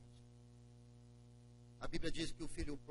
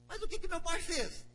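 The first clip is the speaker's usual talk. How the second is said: much higher, urgent question; anxious older brother; 14 semitones higher, 5 dB louder than usual. Acted. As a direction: press to know what father did. Broer vraagt naar vader.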